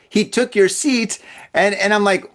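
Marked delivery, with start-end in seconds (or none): 0.1-1.2 slow, higher rising question, imitating him; mock incredulity. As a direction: stretch it out in mild mock disbelief. Boyfriend's incredulous reaction, retold.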